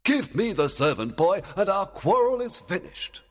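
Oberon. A narrator's reading. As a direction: order her as an impatient king. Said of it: "Oberon said".

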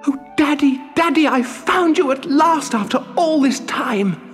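very high, elated puppet cry; overcome with joy. Acted. Pinocchio finding his long-lost father, high cry of joy. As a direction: burst out high and overjoyed. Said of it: "overcome with joy".

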